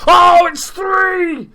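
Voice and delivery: drawn-out ecstatic scream at the screen; goal celebration shout. Real, not acted. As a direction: bellow it as the third goal hits the net. Third goal goes in.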